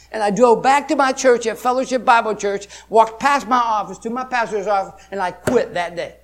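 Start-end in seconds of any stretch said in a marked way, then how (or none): none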